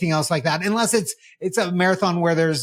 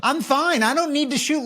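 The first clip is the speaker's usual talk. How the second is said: mock refusal; 7 semitones higher than usual. Real, not acted. Refusing lasers again.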